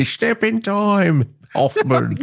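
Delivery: slow, high sung-out Cockney; mock Cockney. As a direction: stretch it like a music-hall number.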